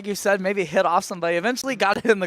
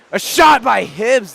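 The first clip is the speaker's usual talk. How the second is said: excited call; 4 semitones higher, 7 dB louder, slower than usual. Real, not acted. Calling a shot on goal.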